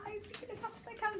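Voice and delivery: high, soft rapid gabble after a held "I'm"; long I, then fast. Shown as a demonstration. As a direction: hold "I'm", then race through the rest. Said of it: "the I takes long".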